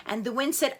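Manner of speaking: ordinary delivery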